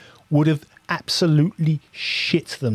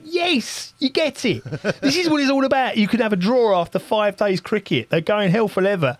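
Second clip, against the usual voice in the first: excited; 6 semitones higher than usual. Retold or imitated. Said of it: "I went".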